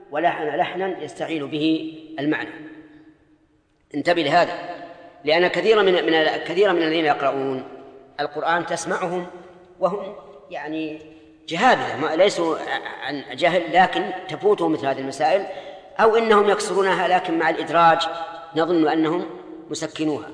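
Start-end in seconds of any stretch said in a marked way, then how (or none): none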